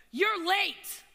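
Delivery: a furious boss calling out a latecomer; angry boss. Retold or imitated. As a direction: snap it like a harsh boss. Boss snapping at late employee.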